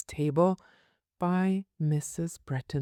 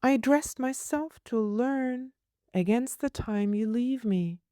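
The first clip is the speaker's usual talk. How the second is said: put-on high, prim little girl asking; 8 semitones higher than usual. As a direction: voice a fussy small girl, pitched high. Child voice.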